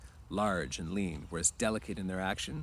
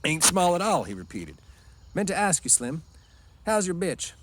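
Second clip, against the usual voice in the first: higher and louder, hearty and blunt; Carlson's big voice; 10 semitones higher, 6 dB louder than usual. Acted. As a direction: play the blunt big ranch hand. Carlson voiced high and loud.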